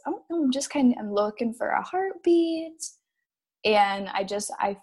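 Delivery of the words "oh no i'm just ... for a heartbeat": doctor's voice. Retold or imitated. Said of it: "and then she goes".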